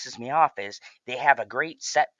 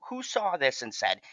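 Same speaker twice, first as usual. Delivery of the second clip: ordinary talk, nothing marked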